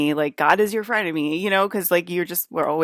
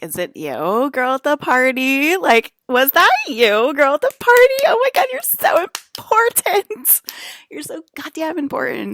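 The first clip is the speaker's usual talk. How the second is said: mock fangirl; 10 semitones higher, 8 dB louder than usual. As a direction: gush like an overexcited fan at a party.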